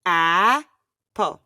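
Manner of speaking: APPLE drawn out slowly, syllable by syllable. Shown as a demonstration. Co-host asked to say the word, slowly. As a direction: stretch APPLE out into two slow beats. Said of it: "Now say it slowly".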